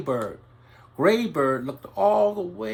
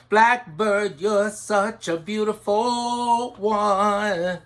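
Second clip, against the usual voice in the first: slow, higher, louder admiring praise; admiring birdsong praise; 4 semitones higher, 6 dB louder, slower than usual. Acted. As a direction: lift it up and slow, full of admiration. Birds sing Blackbird's praises.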